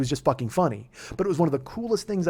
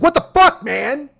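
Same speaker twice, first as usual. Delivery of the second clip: high, loud outraged yell; 11 semitones higher, 8 dB louder, slower than usual. Retold or imitated. Driver reacting to his Uber rating dropping, with a high, loud outraged yell. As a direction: explode at the rating drop. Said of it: "I'm like".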